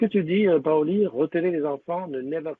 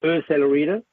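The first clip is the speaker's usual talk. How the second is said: louder app name in an English accent; 2 semitones higher, 8 dB louder than usual. Shown as a demonstration. Streamer repeats the app name to viewers, louder English accent. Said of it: "avec l'accent anglais".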